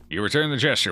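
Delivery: ordinary talk, nothing marked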